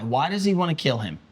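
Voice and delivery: normal talking voice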